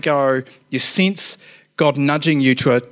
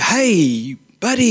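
mock-chummy, drawn-out hail; muppet-like greeting; 5 semitones higher, much slower than usual. Youth worker imagining greeting a rough guy, mock-chummy. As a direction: ham up an awkward buddy greeting. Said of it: "sound like a bit of a muppet".

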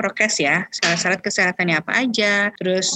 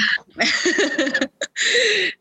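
laugh; 9 semitones higher than usual. Real, not acted. Laugh after quip.

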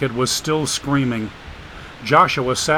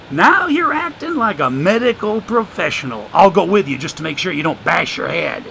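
put-on higher, sly voice of an old drifter; streetwise homeless man; 4 semitones higher, 3 dB louder than usual. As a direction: play the wisecracking drifter, amused. Joshua's voice in the story.

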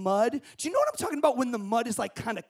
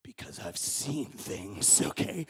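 much lower, slow haunted voice; haunted veteran; 11 dB quieter, slower than usual. Pretend. Telling the audience why no mud, much lower haunted veteran voice. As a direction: say it like a war survivor. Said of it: "I've got a little P T S D".

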